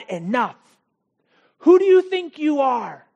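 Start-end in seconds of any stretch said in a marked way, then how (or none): 1.6-3.1 loud, higher indignant challenge; angry leaders. Acted. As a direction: fling it at him in outrage. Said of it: "they say".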